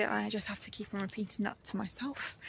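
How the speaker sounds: hushed reassuring murmur; whispered self-talk. Retold or imitated. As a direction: whisper it to yourself to stay calm. Calming self-talk in the car.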